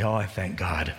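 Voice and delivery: ordinary talk, nothing marked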